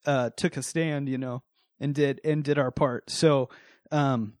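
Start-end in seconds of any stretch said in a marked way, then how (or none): 0.0-3.1 holding back real emotion; getting emotional. Real, not acted. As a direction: soften as feeling catches up with you. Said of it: "I'm getting emotional for some reason".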